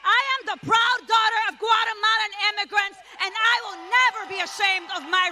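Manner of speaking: high, loud, defiant proclamation. Real, not acted. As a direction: shout it so the president hears. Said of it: "I'm gonna say it again".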